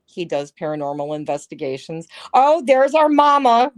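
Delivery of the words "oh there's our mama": loud, higher-pitched delighted call; light up as you spot a friend; excited greeting. Real, not acted. Streamer spotting their mama Rosalind in the chat, excited greeting.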